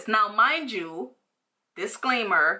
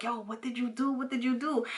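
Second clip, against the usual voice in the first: hushed classmates; 4 semitones higher, 7 dB quieter than usual. Retold or imitated. Classmates whispering reaction.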